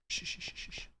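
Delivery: quick run of soft shushes; repeated quiet "shh"; shushing. Real, not acted. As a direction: hush the room to listen. Hushes chat to hear a sound.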